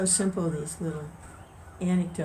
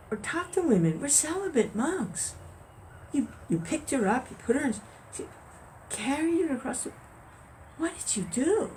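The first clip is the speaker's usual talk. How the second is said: higher, incredulous scolding monk; the scandalized monk scolding his friend, higher pitched; indignant monk; 7 semitones higher than usual. Acted. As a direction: protest in shocked disbelief. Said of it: "he's like".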